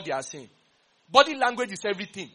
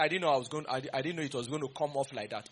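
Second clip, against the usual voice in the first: mock excuse; 5 semitones lower, 4 dB quieter than usual.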